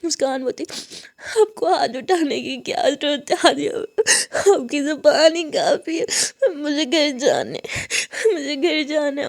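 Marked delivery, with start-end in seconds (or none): 1.4-9.3 put-on crying like a child, voice breaking; sobbing. Hurt student wanting to go home, sobbing. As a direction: sob out the hurt between gasps. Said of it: "और ऐसे रोने लगती".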